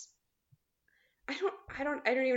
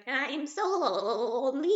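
slow, higher, laughing [name] imitation; [name] impression; 5 semitones higher, slower than usual. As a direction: croon it like [name], slowly. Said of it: "the voice from the [name] song".

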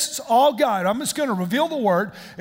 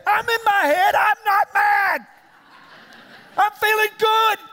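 high, strained shouting; mock furious denial; 9 semitones higher, 4 dB louder than usual. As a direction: deny being mad while plainly yelling. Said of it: "You're furious".

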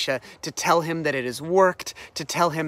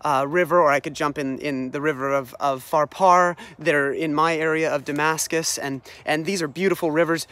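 ordinary delivery

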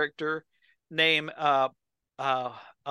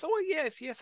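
lightly voiced eager woman, pitched up; a hint of a flirty lady jumping at the offer; mock coy "oh yes"; 12 semitones higher, 5 dB quieter than usual. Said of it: "and she goes".

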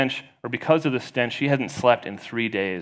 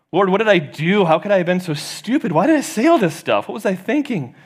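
high, louder frantic questions; panicked self-reproach; 9 semitones higher, 6 dB louder than usual. Acted. Shani alone in her cell regretting her words, high and frantic. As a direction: spiral into panic at your own foolishness.

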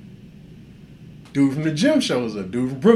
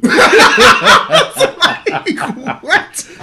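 high, loud laughing question; laughing while quoting his own surprise; 9 dB louder, much slower than usual. Real, not acted.

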